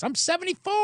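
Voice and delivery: high-pitched crowing boast; gloating old golfer. Retold or imitated. Older golfer laughing at the players he beats, high gloating. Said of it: "He's laughing at us".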